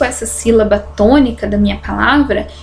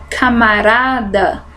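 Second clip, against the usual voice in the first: "camarada" slow, with a long RA; drawn-out, strong RA in "camarada"; stress on penultimate syllable; slower than usual. Shown as a demonstration. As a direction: lean on RA in "camarada". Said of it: "tenho a penúltima".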